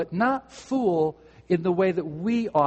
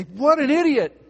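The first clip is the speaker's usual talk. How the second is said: higher, louder insult at a driver; mock road rage; 9 semitones higher, 5 dB louder than usual. Driver cut off in traffic, mock angry shout. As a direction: snap it at the car ahead. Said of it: "and you say".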